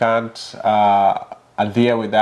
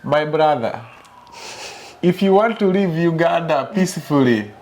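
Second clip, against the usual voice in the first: imitated Ugandan driver, pitched higher; higher-voiced warning in Ugandan accent; 5 semitones higher than usual. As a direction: mimic a Ugandan accent, voice lifted. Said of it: "explained it to me with a Ugandan accent".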